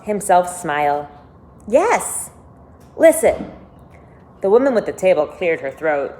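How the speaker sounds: lively read-aloud narration; expressive storybook reading with lifted quotes; story reading. A narrator's reading. Reads novel aloud to listeners.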